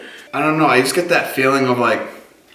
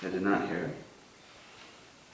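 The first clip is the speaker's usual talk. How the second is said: slow, hushed, uneasy whisper; nervous night vlog whisper; 6 semitones lower, 17 dB quieter, much slower than usual. Real, not acted. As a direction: barely breathe the words. After "do you hear that.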